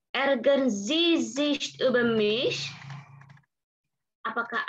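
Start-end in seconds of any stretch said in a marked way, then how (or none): 0.1-2.7 slow model question. Shown as a demonstration. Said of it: "teacher models formal question".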